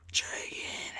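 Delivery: breathy whisper, no voice at all. Shown as a demonstration. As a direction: whisper it like a secret taunt.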